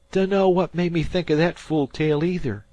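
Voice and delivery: put-on high, loud boy's voice, insistent; he swears the dead man came back; spooked fisher boy. A narrator's reading. Dan to Harvey, spooked high boy voice. Dan sheepish after scare.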